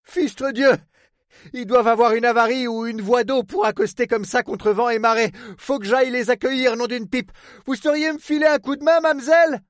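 swear, then urgently appeal for help; alarmed harbour superintendent. Acted. Port man asks the woman to help, alarmed.

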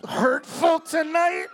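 slow, loud, high hammed-up complaint; over-the-top mock hurt; mock wounded shout. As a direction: wail it like a wounded diva. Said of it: "be like".

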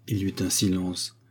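usual speaking voice

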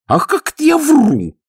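loud, high-pitched indignant outcry; maid's high, outraged exclamation. A narrator's reading. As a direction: protest as if truly offended. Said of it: "очень натурально возмутилась Манюшка".

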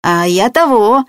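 goofy, grinning simpleton; foolish grin. A narrator's reading. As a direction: answer with a dopey grin in the voice. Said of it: "Петька глупо ухмыльнулся".

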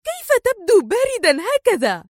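high-pitched, furious reproach; indignant, very high character voice; exasperated cry. A narrator's reading. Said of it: "فصاحت به سونيا في حنق".

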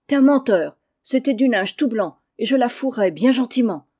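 loud shrill scolding of her husband; angry shouting. Acted. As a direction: yell back at him, fed up. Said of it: "criant à qui mieux mieux". (femme qui crie contre son mari)

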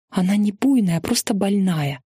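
ordinary delivery

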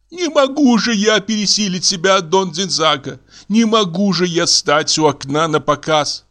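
high, plaintive woman's voice; distraught Carolina. Acted. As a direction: protest as if close to tears.

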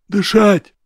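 high gasped plea; choked wheeze. A narrator's reading. Rorik pinned by the throat, high choked wheeze. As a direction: wheeze out one word for air. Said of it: "хрипел тот".

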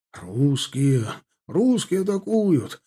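mild alarmed call, stretched in the middle; cry of alarm. A narrator's reading. Alarm passing from company to company, mild cry. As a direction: raise the alarm down the ranks. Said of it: "неслось от роты к роте".

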